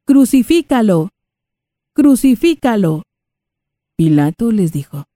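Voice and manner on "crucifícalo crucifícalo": raised angry mob cry; crowd shouting. Acted. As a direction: shout it like a mob calling for death.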